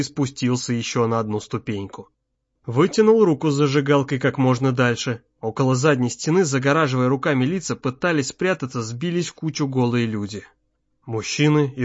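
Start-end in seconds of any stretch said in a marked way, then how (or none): none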